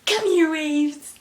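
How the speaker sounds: high, loud, slow summoning call; grand summoning. Pretend. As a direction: call the waves like a sorceress. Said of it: "Tiders beckoned waves".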